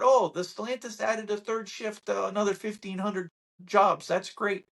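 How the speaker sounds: high, chirpy "That's great"; mock reporter. Mocking the media's third-shift report, chirpy. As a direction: parody a shallow news report. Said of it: "They'll report".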